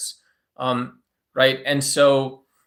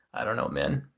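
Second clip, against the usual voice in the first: much lower, softer put-on troll voice; 11 semitones lower, 7 dB quieter than usual. He answers chat with his promised troll voice, deep and soft. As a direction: growl it low like a lazy troll. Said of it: "get my troll voice on".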